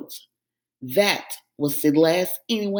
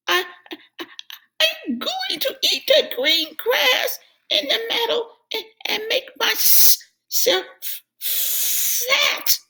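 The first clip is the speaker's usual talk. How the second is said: very high squeaky little goat; a tiny goat piping up bravely. A narrator's reading. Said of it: "said the goat".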